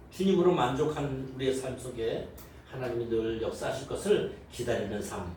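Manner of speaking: ordinary talk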